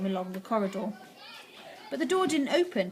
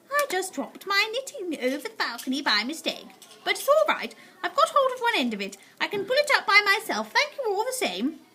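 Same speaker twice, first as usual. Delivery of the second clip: put-on higher, easy old lady voice; cool grandmother; 10 semitones higher than usual. A narrator's reading. Grandmother coolly explaining the knitting to the witch. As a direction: stay perfectly unbothered. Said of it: "the coolness of her voice".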